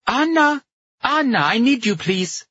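put-on loud high call, thick accent. A narrator's reading. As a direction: call out from another room. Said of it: "An unseen woman with a thick accent called".

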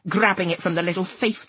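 plain reading voice

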